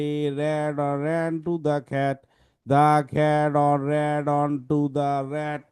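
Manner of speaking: slow drone with no stops at the full stops; wrong: run-on reading. Shown as a demonstration. Teacher showing students how not to read. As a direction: read it all as one endless line, ignoring the stops. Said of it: "তোমাকে এভাবে পড়া যাবে না".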